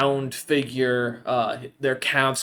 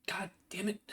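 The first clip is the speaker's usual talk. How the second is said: whispered curse; 6 semitones higher, 13 dB quieter than usual. Acted. Rilvi swears under her breath.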